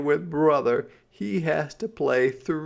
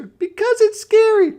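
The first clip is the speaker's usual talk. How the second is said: put-on very high, loud little girl; little sister voice; 11 dB louder than usual. A narrator's reading. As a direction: squeak it like a small stubborn girl. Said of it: "said Sister".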